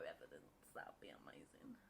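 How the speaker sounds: low breathless whisper. Real, not acted. Gloating aside about the video, a whisper. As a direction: whisper it with glee.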